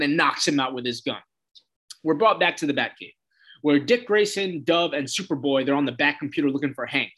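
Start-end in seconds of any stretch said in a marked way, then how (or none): none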